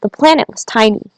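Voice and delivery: usual speaking voice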